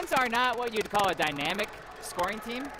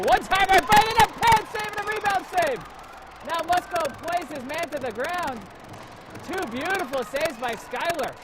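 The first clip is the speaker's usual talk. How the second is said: high loud rapid save call; excited call; 11 semitones higher, 8 dB louder than usual. Acted. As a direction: shout the saves. Save calls in play-by-play.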